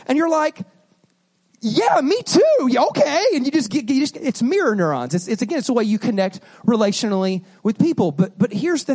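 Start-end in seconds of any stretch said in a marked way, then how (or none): 1.6-3.2 high, drawn-out gleeful agreement; mirrored excitement. Acted. Preacher mimics catching a happy person's mood, excited. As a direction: catch their happiness and play it up. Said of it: "somebody who's so happy and so excited".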